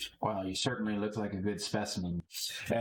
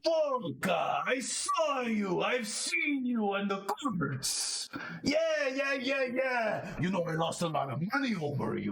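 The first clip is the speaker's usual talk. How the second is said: high, shouting, starstruck; excited fan; 10 semitones higher than usual. Acted. A shifter meeting the player he bet on, high, excited shouting. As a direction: gush at the famous player.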